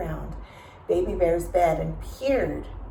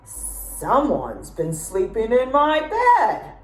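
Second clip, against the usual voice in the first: slow, loud mock bear roar; Papa Bear roaring; 5 semitones higher, 4 dB louder, slower than usual. A narrator's reading. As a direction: drag out the roar like a big bear. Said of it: "Papa Bear roared".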